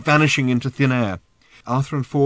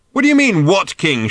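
high, loud exasperated yell; indignant high-pitched shout; 8 semitones higher, 7 dB louder than usual. A narrator's reading. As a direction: shout back incredulously. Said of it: "shouted Ford".

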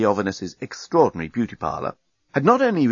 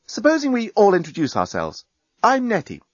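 put-on lightly higher young woman; 9 semitones higher than usual. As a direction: lilt it up as Nettie. Nettie voiced in story.

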